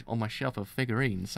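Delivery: ordinary talk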